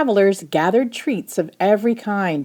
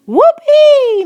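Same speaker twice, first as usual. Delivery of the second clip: a very high, very loud whoop of delight; joyful shout; 10 dB louder than usual. Acted. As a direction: whoop it out mid-backflip. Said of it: "Missus Jenkins shouted".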